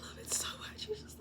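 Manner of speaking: voiceless, very soft gush; delighted whisper. Real, not acted. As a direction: whisper it as if worn out from laughing. Breathless from laughing, delighted aside.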